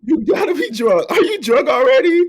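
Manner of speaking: high, loud laughing shout; cracking up while teasing. Real, not acted. Teasing friend for giggling.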